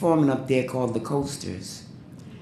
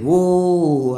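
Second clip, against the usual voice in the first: loud, higher stage call into the mic; singer's whoa; 4 semitones higher, 5 dB louder than usual. Retold or imitated. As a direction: belt it out to the crowd like a showman. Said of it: "He said".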